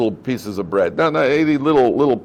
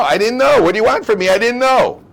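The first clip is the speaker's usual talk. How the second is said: high, whiny protest of innocence; mock whiny excuse; 10 semitones higher, 6 dB louder than usual. Mocking the culprit who claims he didn't know. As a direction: play the guilty one pleading ignorance.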